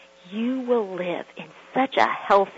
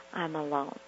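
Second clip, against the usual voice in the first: relieved quiet; 4 semitones lower, 9 dB quieter than usual. Retold or imitated. She breathes after slamming door.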